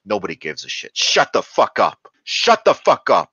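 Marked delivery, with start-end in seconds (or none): 0.9-2.1 louder, higher snapped yell; mock angry yell. Mimicking a teacher shouting down a gifted kid, mock angry yell. 2.2-3.3 much higher and louder, full-force yell; mock furious shout. Mimicking a teacher shouting down a gifted kid, even louder mock yell.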